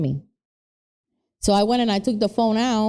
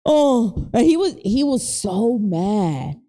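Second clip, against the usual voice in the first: ordinary talk, nothing marked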